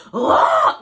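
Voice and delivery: very high, loud scream; imitated scream. Imitating the guy behind her, very high loud scream. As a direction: scream right into her ear. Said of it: "like, in my ear".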